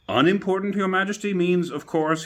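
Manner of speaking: high, loud, fussy courtier voice. A narrator's reading. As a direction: correct the king with anxious politeness. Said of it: "he said in a very respectful tone".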